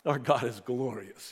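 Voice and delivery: ordinary talk, nothing marked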